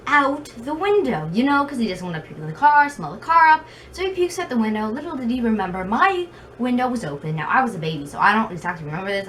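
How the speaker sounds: ordinary delivery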